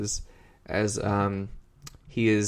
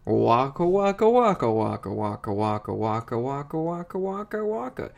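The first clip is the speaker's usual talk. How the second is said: rattled-off waka chant, climbing higher; puppet catchphrase; 4 semitones higher, 3 dB louder than usual. Retold or imitated. Puppet dad to the camera, relentless catchphrase gag. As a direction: start loud, repeat on and on, rising at the end. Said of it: "he just deadpans and goes".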